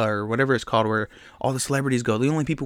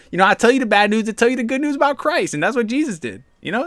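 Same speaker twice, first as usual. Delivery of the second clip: ordinary talk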